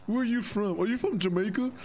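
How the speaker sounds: high-pitched mocking impression of curious strangers; mocking nosy people. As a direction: imitate people asking where he is from. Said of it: "People hear accent".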